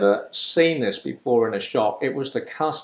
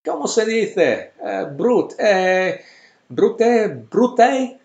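put-on aunt's Spanish accent, rising higher and louder; 9 semitones higher, 7 dB louder, slower than usual. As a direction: search for the word in a Spanish accent, pitching up each time. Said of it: "English, Spanish accent there".

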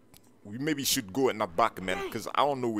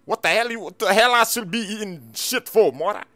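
outraged disbelief; 10 semitones higher, 7 dB louder than usual. Acted. Outraged at insult.

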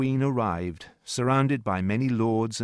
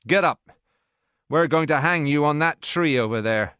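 a louder, harsh order to a prisoner; stern officer's command; 5 semitones higher, 6 dB louder than usual. A narrator's reading. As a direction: bark it at the rebel like a threat.

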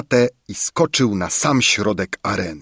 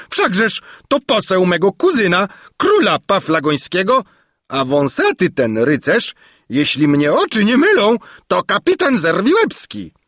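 raised, high, lively greeting; exclaimed welcome; 8 semitones higher than usual. A narrator's reading. King welcoming his cousin's envoy and herald, high exclaiming. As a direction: hail the newcomers with hearty bluster. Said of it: "wykrzyknął król".

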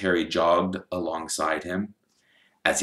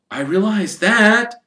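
put-on higher boyish voice, slow and patient; young Harry voice; 8 dB louder than usual. Harry answering Hagrid's scolding, in a lightly voiced boy's tone. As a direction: reassure him you know.

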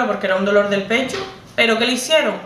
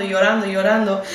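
ordinary talk

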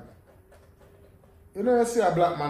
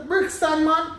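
excited call; 11 semitones higher, 5 dB louder, slower than usual. Retold or imitated. Stranger names where he knows him.

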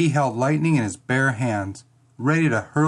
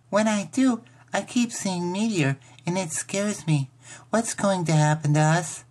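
put-on small, higher child voice, worried; scared little sister; 6 semitones higher than usual. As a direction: confess a fear like a six-year-old.

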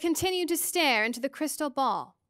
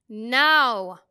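a sharp, much louder order; loud command; 9 dB louder than usual. A narrator's reading. Mrs Which ordering the reluctant medium, a loud command. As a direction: snap it out, leaving no room for argument. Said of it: "Missus Witch commanded".

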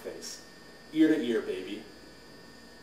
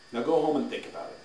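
ordinary delivery

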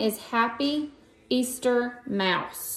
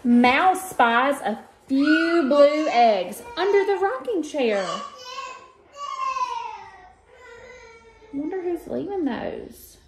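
put-on reading to children; 7 semitones higher, slower than usual. Teacher reading an Easter book to her students, lively storytime voice. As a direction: read it like storytime for little ones. Reads picture book aloud.

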